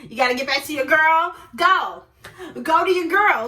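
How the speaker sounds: loud, challenging jab; acting out fed-up dismissal; indignant send-off. Acted sarcastic send-off, escalating.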